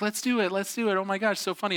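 high, giddy mock excitement; sisters acting it up. Sisters hyping the trust fall for their brother, acting it up. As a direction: gush like excited little sisters. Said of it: "they're really acting it up".

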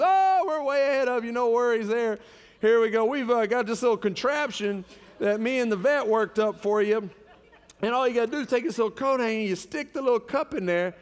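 loud, high-pitched imitation of the wife; hammed-up reassurance; retold breezy assurance. Voices wife breezily explaining her plan.

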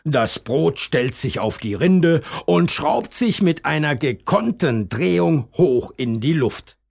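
usual speaking voice

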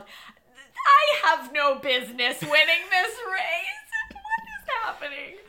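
loud, high, laughing disbelief; yelled in disbelief. Retold or imitated. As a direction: shout it out, half laughing, half crying. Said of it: "I yelled".

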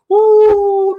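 loud, high ooh of sudden insight; excited ooh. Real, not acted. Sudden exclamation mid-sermon.

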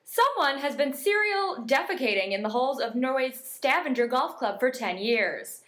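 deliberate news-bulletin delivery. Acted. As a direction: read it out like a newscaster. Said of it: "read this news article, it would say".

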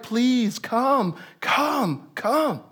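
soft, high whiny begging; mock pleading. Enemies begging Nehemiah four times, mock whiny pleading. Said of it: "They're like".

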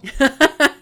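loud, high burst of laughter; real laugh. Real, not acted. Reader amused by a fangirl remark, bursting out laughing. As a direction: crack up suddenly mid-reading.

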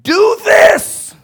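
huge, high-pitched bellow; full-force acted yell; finally shouting. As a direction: explode with the command after being ignored. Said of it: "until finally he says".

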